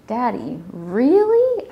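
disbelieving question leaping up high; child's loud, high-rising incredulity; incredulous. A narrator's reading. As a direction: question Dad's idea in disbelief. Daughter doubts Daddy's idea.

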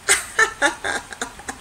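real laugh, mostly breath; airy, voiceless chuckle; breathy laugh. Real, not acted. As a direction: laugh airily between phrases. Laughs at the quote's brick.

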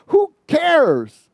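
high, loud "who cares"; loud dismissal. Real, not acted. Preacher scoffing at Facebook selfies, loud and high. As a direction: brush it off with a shout.

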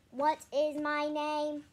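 robot voice. A narrator's reading. Robot waking up asks its own name, slow level voice. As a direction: drone it out like a newly woken robot. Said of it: "the robot said in a mental noi-, voice".

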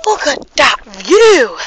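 slow, loud amazement; awed. Real, not acted. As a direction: marvel at it slowly. Amazed at the view.

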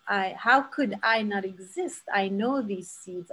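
ordinary delivery

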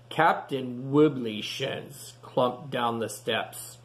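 plain reading voice

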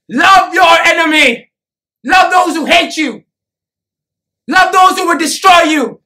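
loud, relentless exhortation; full-voiced sermon at fever pitch; impassioned preaching. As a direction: thunder the commands at the crowd. Preaching a hard command to crowd.

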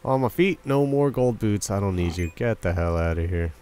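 ordinary talk

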